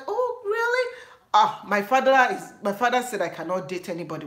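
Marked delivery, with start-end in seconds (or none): 0.1-0.9 very high mock surprise; imitating surprised suitor. Suitor hearing her father's rule, very high surprise. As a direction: gasp it like a let-down guy. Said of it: "They're like".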